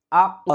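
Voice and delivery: আক with a bounced release on the final q; qalqalah push. Shown as a demonstration. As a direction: push off the last ক of আক. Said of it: "এরকমভাবে আমরা একটু ধাক্কা".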